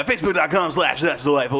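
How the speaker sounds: automatic, memorised announcer patter; rote recital. Shown as a demonstration. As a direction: reel off the web address with your mind elsewhere. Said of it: "while your mouth is saying".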